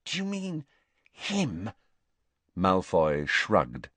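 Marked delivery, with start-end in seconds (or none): 0.0-1.7 high, soft, stunned question; small, astonished voice; dumbfounded. A narrator's reading. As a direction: ask it hushed and pitched up. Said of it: "looking dumbfounded".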